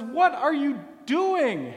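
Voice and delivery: loud, high, slow indignant challenge; indignant demand. Acted. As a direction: confront him with outrage. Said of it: "looks Jesus in the eye indignantly".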